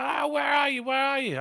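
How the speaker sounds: imitating a boozed-up friend shouting; pissed-up mate. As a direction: holler like a drunk pal on the phone. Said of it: "He's pissed up".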